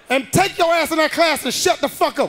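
high, loud scolding mom; angry mother. Retold or imitated. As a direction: bark orders like a mom. Mother ordering.

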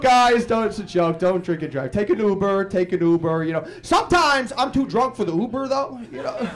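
normal talking voice

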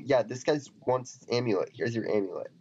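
normal talking voice

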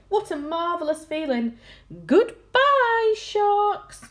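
high, elated exclamations; delighted cries. A narrator's reading. Crew waving off the sharks, delighted cries. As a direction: cry out gleefully, peaking on the goodbye. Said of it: "they cried".

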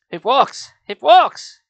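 loud high cry, building on the repeat; alarmed disbelieving yell; startled shout. Acted. Uncle's startled cry, acted again.

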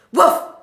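short, very loud bark; dog woof. A narrator's reading. Dog hears of the plot, loud barking. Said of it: "said the dog".

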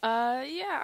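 normal talking voice

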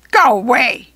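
loud, gruff order from a goblin; goblin shout. A narrator's reading. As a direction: shout it to drive them off. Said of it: "he shouted".

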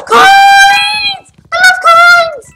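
very high, drawn-out excited shout; gasp, then squeal with delight; gleeful squeal. Real, not acted. Player spotting coins in the game, gleeful squeal.

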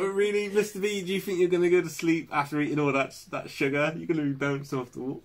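high teasing scold; playful telling-off in a high voice; mock scolding. Teasing Mister B on stream.

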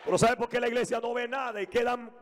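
high, fired-up pulpit preaching; rally the church from the pulpit; preaching at full force. Acted. Pastor rousing the congregation with fiery preaching.

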